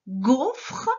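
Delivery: GAUFRE slow, in two syllables with sounded E; sounded-out word. Shown as a demonstration. Teacher making the first sound heard, careful syllables. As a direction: separate GAU and FRE clearly. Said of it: "on entend g au départ".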